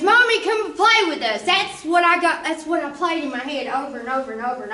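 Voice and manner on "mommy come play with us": higher, lightly voiced little child; kids calling mommy. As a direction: plead like small kids at the door. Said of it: "their little fingers".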